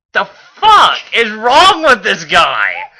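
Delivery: slow, loud incredulous outburst; frustrated outburst. Real, not acted. Reader exasperated with Optimus, slow loud outburst. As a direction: vent at the character.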